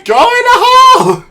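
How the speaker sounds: very high, very loud shriek; desperate scream. Real, not acted. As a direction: scream at the ball at full force. Yelling at the ball.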